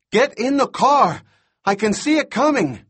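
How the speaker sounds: very high, loud frantic shout; hysterical warning. A narrator's reading. Boy on radio sees it coming, hysterical shout. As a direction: yell the warning in a panic. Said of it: "He sounds completely hysterical".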